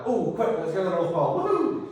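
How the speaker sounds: high, loud, giddy cheer rising to a whoop; merry explorer. Retold or imitated. Eager explorer setting off for the Pole, giddy and loud. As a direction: bounce in like a thrilled kid off on an adventure. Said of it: "You're merry, happily gayly dancing away".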